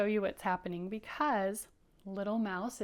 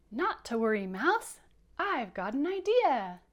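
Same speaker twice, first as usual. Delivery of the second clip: higher and louder, eager; excited Rabbit; 3 semitones higher, 6 dB louder than usual. Acted. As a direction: burst with a bright idea. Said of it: "you're so excited".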